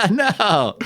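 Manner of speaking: high loud laugh into "No."; a real laugh of disbelief. Real, not acted. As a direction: laugh up high then protest. Disbelief at game outcome.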